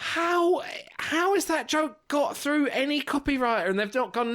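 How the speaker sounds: high-pitched, exasperated disbelief; real incredulity. Real, not acted. Co-host asking how the joke passed any copywriter, incredulous. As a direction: push the voice up in disbelief. Aghast that joke was published.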